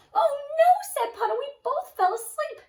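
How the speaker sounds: loud, high alarmed cry; dismayed. Acted. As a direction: realize the blunder aloud. Waking up to missed plan.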